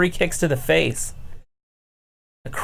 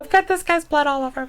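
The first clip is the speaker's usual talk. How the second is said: squeamish wrestler voice. Retold or imitated. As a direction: pretend to be a grossed-out fighter. Same mimicked wrestler continues.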